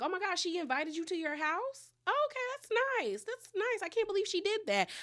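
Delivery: high, quick, put-on sweetness; Kelly. Kelly reacting to the house invitation, exaggerated high-pitched surprise. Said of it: "Kelly was like".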